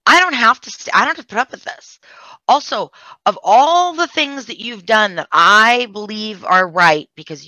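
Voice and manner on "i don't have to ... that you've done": retold defiance. Defying mother, retold confrontation.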